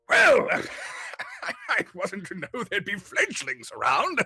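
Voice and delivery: high, loud, chuckling eccentric wizard voice; jolly old wizard. Acted. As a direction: ramble on merrily like a batty old mage. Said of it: "he's like".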